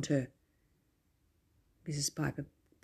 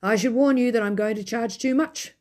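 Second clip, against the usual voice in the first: cross; 6 semitones higher, 6 dB louder than usual. Acted. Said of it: "Mister Murgatroyd said crossly".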